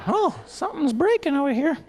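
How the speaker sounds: put-on high, fluttery old neighbour voice; old lady. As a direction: play the chatty old lady startled by glass.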